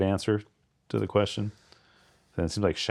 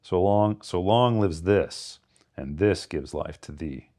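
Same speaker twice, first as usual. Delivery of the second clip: slow, measured line, hammering each "this"; hammered THIS; slower than usual. Shown as a demonstration. Reader showing how the last line should be pronounced, hammering "this". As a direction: draw it out and lean hard on "this". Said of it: "hammer as you pronounce it".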